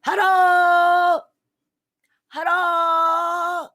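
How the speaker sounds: high, loud tiny-voiced shout; shrill little hero yelling hello; calling out. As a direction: call up to the castle doors from far below. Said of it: "and he called out".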